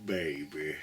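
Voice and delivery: slow, low radio host voice; a lazy late night host; Marty voice. Pretend. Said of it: "in Marty voice".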